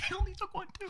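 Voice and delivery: very high falsetto disbelief; incredulous echo. Acted. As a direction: squeak the question back in mock disbelief.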